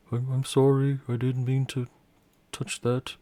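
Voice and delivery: put-on small scared kid saying sorry; shaken child. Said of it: "still a little bit shaken".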